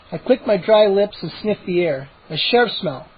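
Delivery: usual speaking voice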